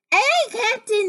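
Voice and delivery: high, loud crew reply; little toadlets' voices. Acted. Toadlets answering their captain, high eager agreement. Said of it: "the Toadlets agreed".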